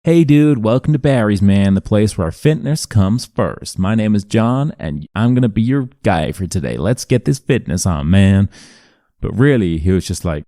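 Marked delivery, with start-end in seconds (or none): 0.0-8.5 hammed-up North American gym-bro greeting; imagined Canadian accent. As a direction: parody an upbeat Canadian fitness host. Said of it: "expecting him to be like".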